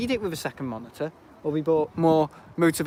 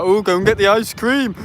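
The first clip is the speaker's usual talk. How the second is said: mock shout; 5 semitones higher, 5 dB louder than usual. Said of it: "they shout about".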